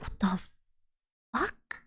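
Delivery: muttered in disbelief; quiet shocked aside; stunned under breath. Retold or imitated. Shocked reaction to landlord.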